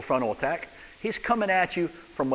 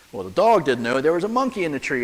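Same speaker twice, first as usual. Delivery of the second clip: usual speaking voice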